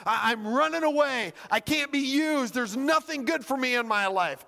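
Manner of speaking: high, insistent despair, no real tears; retold sobbing girl. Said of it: "and she's sobbing".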